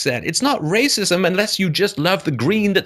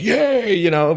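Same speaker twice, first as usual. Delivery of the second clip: a quick, higher mock "Yay" of a crowd; mock cheer; 4 semitones higher, much slower than usual. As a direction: imitate a cheering crowd lightly. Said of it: "people are like".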